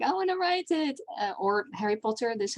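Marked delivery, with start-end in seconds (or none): 0.0-1.0 put-on much higher, a young fan's keen squeal; eager fan. A young fan hooked on Sherlock, in an eager high squeal. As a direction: pitch it up like an eager new fan. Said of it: "and you're like".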